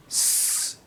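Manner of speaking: س as a pure hiss with no voice; voiceless s demo. Shown as a demonstration. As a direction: breathe out a long س without the voice.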